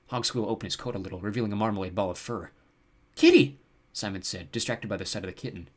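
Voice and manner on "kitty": high, loud squeal of discovery; excited, pitch shooting up; delighted exclamation. A narrator's reading. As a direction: exclaim at something adorable. Simon delighted at sight of kitten.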